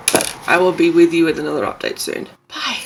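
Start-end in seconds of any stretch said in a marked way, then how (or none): none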